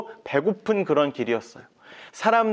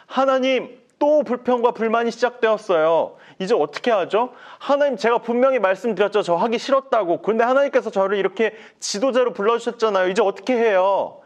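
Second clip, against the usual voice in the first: higher, pleading complaint to God; distressed Moses; 6 semitones higher than usual. Acted. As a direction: beg for help, at wit's end. (높게 하소연하는 모세)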